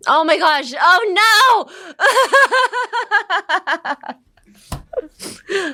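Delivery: high, loud real shock; dismayed outburst. Real, not acted. Speaker catches herself saying um, loud dismayed shriek. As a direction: cry out at catching your own slip.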